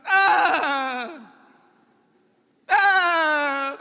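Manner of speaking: loud hammed-up crying shout; mock wailing. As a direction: wail like a paid mourner, overdone on purpose. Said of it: "ahí estaban las viejas esas". (grito de llanto fingido, muy fuerte)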